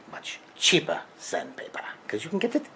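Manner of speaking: put-on hushed Freudian-accent whisper. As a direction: breathe it as a secret aside. Aside while planning.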